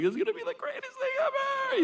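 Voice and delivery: high, fast gleeful dad voice; excited dad. Retold or imitated. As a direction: squeal it like a thrilled father. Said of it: "My dad's like".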